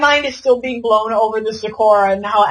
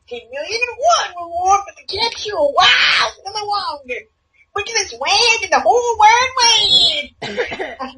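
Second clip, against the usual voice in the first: higher, hammed-up crier voice; mock Wawa Wander shout; 8 semitones higher than usual. Friend copying the Wawa Wander bit. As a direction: ham it up like a bounty crier. Friend copies same impression.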